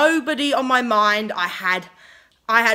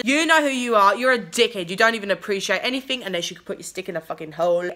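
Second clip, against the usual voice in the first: usual speaking voice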